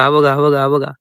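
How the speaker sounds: ordinary talk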